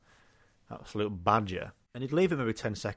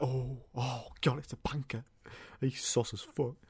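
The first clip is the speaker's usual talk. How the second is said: softer, murmured crowd gossip; hushed worried crowd; 8 dB quieter than usual. Retold or imitated. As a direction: mutter nervously like an anxious audience. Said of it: "The crowd are like".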